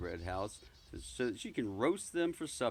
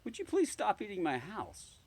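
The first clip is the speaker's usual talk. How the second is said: polite request; 9 semitones higher than usual. Acted. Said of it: "I said politely".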